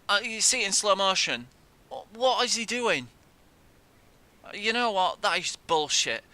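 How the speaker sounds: high, loud real outrage; outraged. Real, not acted. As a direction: yell at the replay. Replay outrage.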